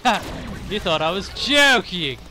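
loud, hammed-up gloating; spring the fake threat loudly, drawing out the last words; playful villain reveal, punched loud; mock villain gotcha. Acts out a knife-wielding prankster's gotcha.

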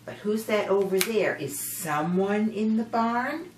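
plain reading voice

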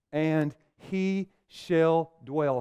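very slow, leaving it hanging; slow drawn-out reading. Acted. Preacher reading the verse slowly, holding for an answer. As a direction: stretch the verse to build suspense.

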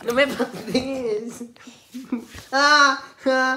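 high, slow tickled plea; being tickled. Real, not acted. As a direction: squeal through tickling.

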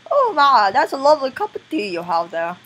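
hammed-up British accent, high opening; bad tea-time British accent. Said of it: "That's my worst accent ever".

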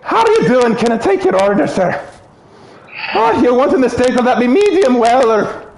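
high, tipsy Irish lilt; drunk Irish accent. Acted. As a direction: play a sozzled Irish waitress. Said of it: "I'd go more Irish".

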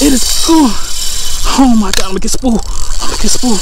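loud, high cries while fighting a fish; excited shouting. Real, not acted. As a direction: call out urgently mid-struggle. Fighting fish, then losing it.

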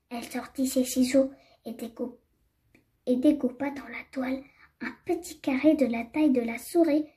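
usual speaking voice

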